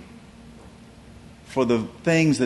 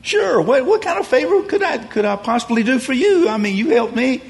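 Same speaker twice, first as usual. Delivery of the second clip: very high, keen, louder; eager offer; 11 semitones higher, 3 dB louder than usual. Retold or imitated. Debtor eagerly offering the steward a favor, high and keen. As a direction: jump at the chance to help.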